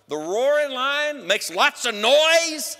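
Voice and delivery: loud and high, drawn out slowly like a roar; roaring lion. Acted. Preacher contrasts the roaring lion with the devil's whisper, loud slow roar.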